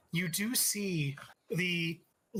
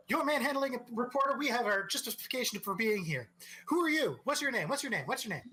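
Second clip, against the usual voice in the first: pushy reporters; 7 semitones higher, much faster than usual. Acted. Reporters mobbing the brute, higher fast badgering voice. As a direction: hammer the questions like a hungry press pack.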